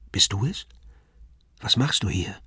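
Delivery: hushed, half-whispered questions; softly spoken. A narrator's reading. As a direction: ask gently under your breath. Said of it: "sagte ich leise".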